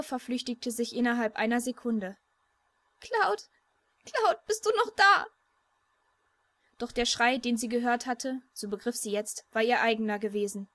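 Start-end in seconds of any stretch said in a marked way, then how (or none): 3.0-5.3 high, tearful, rising call; crying scream. A narrator's reading. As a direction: cry out for him in tears. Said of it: "Dann hörte Tifa einen Schrei".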